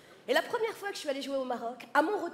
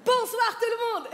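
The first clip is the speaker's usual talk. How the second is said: higher, slower, beaming hello; grand entrance greeting; 7 semitones higher, 4 dB louder, slower than usual. Pretend. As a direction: make a big show of arriving. (salut théâtral et enjoué)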